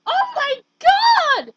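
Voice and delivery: a loud, slow, stretched-out yell of joy; excited shout. Real, not acted. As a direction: shout it out in disbelief. Reacting to the game reward.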